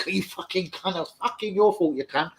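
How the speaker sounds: high-pitched imitation of a blaming rant; mock angry blaming. Defender blaming a teammate for his own mistake, mock angry. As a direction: act out a player turning on a teammate.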